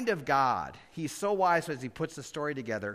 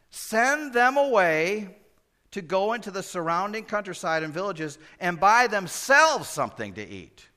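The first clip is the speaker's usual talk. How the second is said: loud urging; 8 semitones higher, 9 dB louder than usual. Acted. Disciples urging Jesus loudly.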